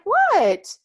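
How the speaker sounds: very high, very loud, shocked What; startled what. Retold or imitated. The speaker stunned by her spirit guides, a shocked, loud, high what. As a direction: blurt it out in disbelief. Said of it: "I'm like".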